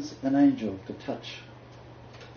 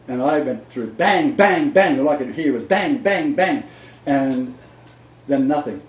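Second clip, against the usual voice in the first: vivid crash retelling; 3 semitones higher, 7 dB louder than usual. Retold or imitated. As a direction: punch each bang louder.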